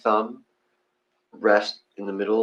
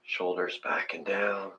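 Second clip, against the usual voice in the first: guided meditation; 7 dB quieter than usual. Acted. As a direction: talk them gently into their breath. Leads breathing meditation.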